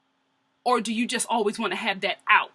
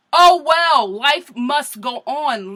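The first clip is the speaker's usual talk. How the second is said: loud, high "oh well" then slow drawn-out; mock-shrug sass; sassy dismissal; 5 semitones higher, 10 dB louder, much slower than usual. Real, not acted. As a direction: brush it off with attitude. Sassy advice to stepmom.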